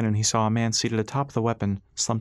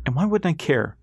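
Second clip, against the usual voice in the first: higher, indignant challenge; flaring temper; 6 semitones higher, 3 dB louder than usual. A narrator's reading. Ras answers Guy's jab, higher-pitched indignant retort. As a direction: throw it back, stung. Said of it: "Ras felt his temper flare".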